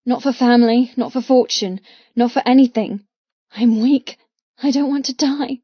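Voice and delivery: ordinary delivery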